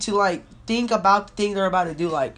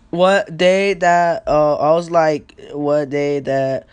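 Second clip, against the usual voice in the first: slow drawn-out question; 3 semitones lower, 5 dB louder, much slower than usual. Retold or imitated.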